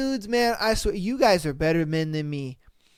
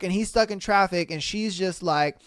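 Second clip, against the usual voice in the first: ordinary talk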